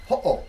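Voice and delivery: Ho'o split by a glottal catch; Ho'o with a short break mid-word; glottal stop. Shown as a demonstration. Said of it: "a little glottal stop".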